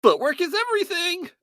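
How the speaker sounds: high-pitched imitated battle yell; Yuri Lowenthal screaming. Co-host imitating a voice-acted game robot, screaming. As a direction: shout it like an over-the-top game voice actor. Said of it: "Yuri Lowenthal screaming".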